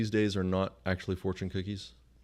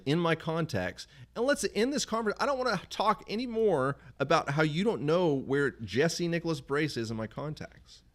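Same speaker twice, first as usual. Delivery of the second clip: ranting at Siri; 9 semitones higher than usual. Retold or imitated. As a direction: lecture the phone that won't listen. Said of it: "It's like".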